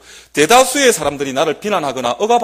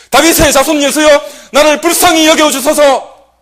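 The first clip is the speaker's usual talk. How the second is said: the blind beggar crying louder to Jesus despite the crowd; desperate shout; 9 semitones higher, 8 dB louder than usual. Retold or imitated. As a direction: cry out over the crowd with all your strength. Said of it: "더욱 크게 소리질러 이르되".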